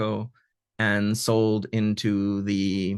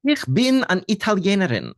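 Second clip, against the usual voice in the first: high, bright and coquettish, much higher; 9 semitones higher, 4 dB louder than usual. Acted. Translator reading the kidnapped girl's line aloud, high and coquettish. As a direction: play the girl passing herself off as Italian. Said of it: "suddenly she says".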